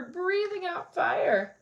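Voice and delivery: ordinary delivery, nothing marked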